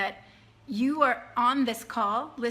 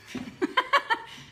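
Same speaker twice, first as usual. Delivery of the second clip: breathy laugh; 13 semitones higher than usual. Real, not acted. Laughs at own accent joke.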